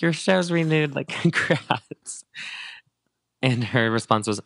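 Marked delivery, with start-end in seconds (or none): 0.0-2.2 higher, slow sarcastic cheer breaking into a laugh; mock congratulations. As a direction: tease her with fake delight. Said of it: "being like".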